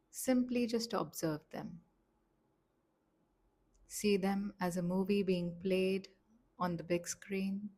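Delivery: slow, gentle meditation voice with long pauses; softly paced relaxation instructions, leaving space to breathe; guided meditation. Acted. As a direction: guide them calmly, phrase by unhurried phrase.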